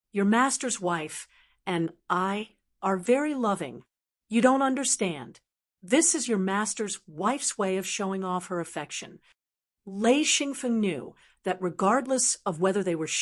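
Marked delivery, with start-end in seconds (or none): none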